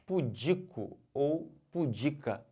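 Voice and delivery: "Pudico ou pudica" slowly, stress on DI in both. Shown as a demonstration. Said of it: "como é que fala isso?". ("Pudico ou pudica" devagar, tônica no DI)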